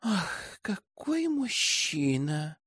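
soft, slow, dreamy sigh; girlish crush, hushed and lingering; swooning admiration. A narrator's reading. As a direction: sigh over him, drawing it out quietly. Girl swoons after departing horseman.

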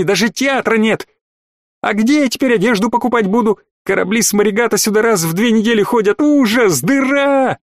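high, whiny furious tantrum; shouting match. Acted. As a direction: rant like a spoiled girl.

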